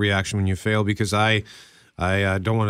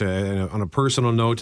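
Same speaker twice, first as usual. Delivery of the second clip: normal talking voice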